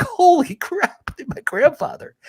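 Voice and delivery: very high, drawn-out cry of disbelief; retold tearful astonishment. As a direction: re-enact a stunned, high-pitched outcry breaking into a laugh. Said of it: "I'm crying. I'm like".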